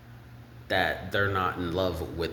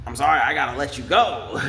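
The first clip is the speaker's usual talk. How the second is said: laughing; 5 semitones higher, 4 dB louder, faster than usual. Pretend. Mock firing, laughing.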